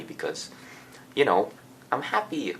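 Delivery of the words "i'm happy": high, mock-cheerful claim; put-on happy. Student keeps up the happy act at school, put-on cheer. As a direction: act happy, pitch swooping up. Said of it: "especially as happy".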